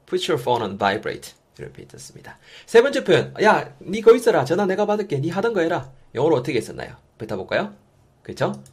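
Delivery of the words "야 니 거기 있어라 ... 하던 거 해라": high, raised-voice call across to someone. Acted. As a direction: call over that you will take care of it. Acts out offering to answer phone.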